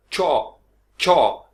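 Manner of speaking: चॉप said slowly with a crisp ch and stopped p; slow drilled ch. Shown as a demonstration. Teacher drilling चॉप slowly for students. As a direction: clip चॉप off at the p. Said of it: "अगर आप रोक लें यहाँ पे".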